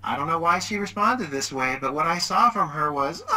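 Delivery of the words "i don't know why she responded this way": ordinary talk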